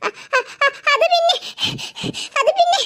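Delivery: frightened stuttering, high and halting; too scared to get the words out; scared stammer. Acted. Ammu stammering in fear to her sister. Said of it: "നീ എന്തിനാ ഇങ്ങനെ പേടിക്കുന്നത്?".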